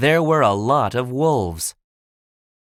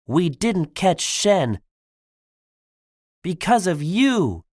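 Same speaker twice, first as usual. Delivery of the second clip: higher-pitched, mildly angry accusation; 7 semitones higher than usual. Acted. Tigress blames Po for losing Shen, mildly angry. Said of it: "Tigress was angry with Po".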